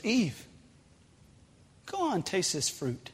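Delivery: soft, hushed serpent voice; serpent tempting Eve. A narrator's reading. Serpent in the tree coaxing Eve, hushed and sly. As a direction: coax her quietly, like a tempter. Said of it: "A serpent wrapped around a tree says".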